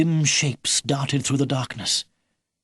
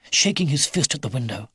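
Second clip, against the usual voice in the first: usual speaking voice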